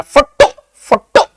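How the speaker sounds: Photo, photo with the टो punched very high and loud, drawn out slowly; stress on the T. Shown as a demonstration. Teacher exaggerating the stressed syllable for students, ज़ोर से टो. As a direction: squeal out the टो in photo twice, exaggerated for the class. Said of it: "टी को कस के बोल रहे हैं हम".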